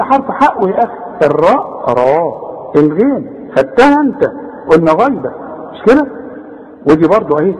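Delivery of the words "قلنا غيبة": normal talking voice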